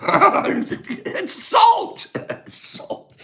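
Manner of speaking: laughing through the words, losing breath; high, helpless giggling speech; real laughing fit. Real, not acted. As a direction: crack up until the voice gives out. Cracking up at old drug memories.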